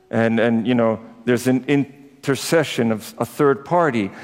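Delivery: ordinary talk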